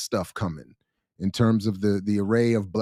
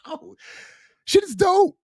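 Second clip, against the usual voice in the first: very high, loud burst of approval; mock rave; 5 dB louder than usual. Giving his review of the book, hammed-up excitement. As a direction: squeal out an over-the-top verdict.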